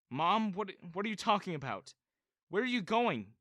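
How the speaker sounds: high, loud panicked questions; panicked asking. A narrator's reading. Son panicking as mom leaves, high frantic questions. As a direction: blurt it out in alarm. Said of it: "I asked in panic".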